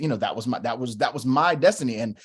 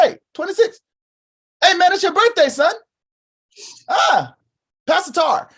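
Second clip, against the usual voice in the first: much higher and louder, calling out across the roof; excited dad; 7 dB louder than usual. Retold or imitated. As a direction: call out the birthday in a high, loud, excited voice. Dad voiced announcing son's birthday.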